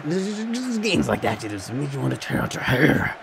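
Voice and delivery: half-voiced Joker rant about a bad game. Real, not acted. FIFA player complaining to his viewers in a Joker-like voice. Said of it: "Why am I sounding like the Joker right now?".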